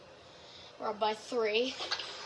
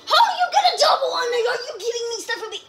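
fast, high-pitched shouted protest; outraged yell; 9 semitones higher, 6 dB louder, much faster than usual. Real, not acted. Player protesting a double in the game, outraged yell. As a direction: rattle it off high and loud in outrage.

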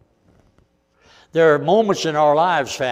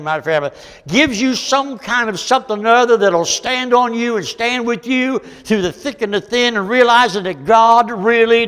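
high, swelling preacher's cry; impassioned preaching; 7 semitones higher, 6 dB louder than usual. Acted. Preacher assuring the congregation that God cares, impassioned preaching. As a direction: lift the voice high and preach it home.

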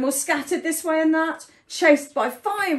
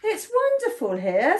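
high, loud call easing into delight; cheery storybook pleading; happy plea; 10 semitones higher, 6 dB louder than usual. A narrator's reading. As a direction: call out eagerly, then happily. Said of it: "sighed Safi happily".

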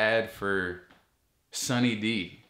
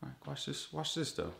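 hushed; 4 semitones higher, 9 dB quieter than usual. Real, not acted. Leaning in to show.

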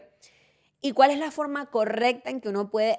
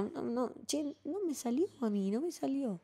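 attempted Argentine accent; 3 semitones higher, 11 dB quieter than usual. Shown as a demonstration. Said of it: "no me salió el acento".

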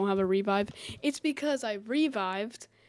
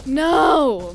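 frustrated shout; 4 semitones higher, 8 dB louder than usual. Real, not acted. Friend just died in game.

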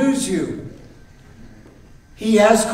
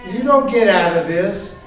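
normal talking voice

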